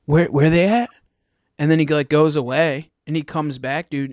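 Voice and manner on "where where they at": louder, higher demanding where; pushy junkie. Retold or imitated. Junkie at the dock demanding bait, louder. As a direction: press him, insistent. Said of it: "And he's like".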